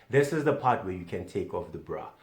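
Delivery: ordinary delivery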